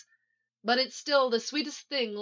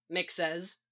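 usual speaking voice

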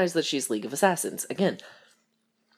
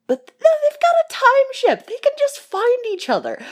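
much higher and louder, hammed-up drama voice; mock dramatic build-up; 6 dB louder than usual. Hamming up a plot twist for viewers, mock dramatic. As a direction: oversell the twist like a trailer voice. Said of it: "build up drama here".

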